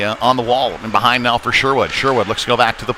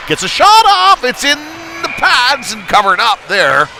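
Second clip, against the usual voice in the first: sharp high, loud spike on the shot, settling as it's covered; excited call; 12 semitones higher, 5 dB louder than usual. Real, not acted. Commentator calling a shot on goal for viewers, high loud excited burst. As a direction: leap up on the shot, then ease down.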